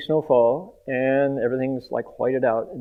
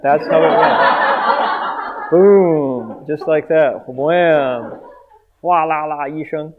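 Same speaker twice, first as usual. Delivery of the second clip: loud, excited impact sounds; hammed-up smash sound effects; acted-out crash; 2 semitones higher, 6 dB louder, much slower than usual. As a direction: boom it out like the crash.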